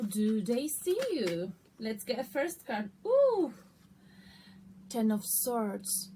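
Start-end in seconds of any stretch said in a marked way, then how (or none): 3.1-3.5 surprised. Real, not acted. Card drawn, excited exclamation.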